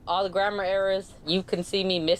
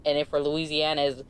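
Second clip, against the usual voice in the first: ordinary delivery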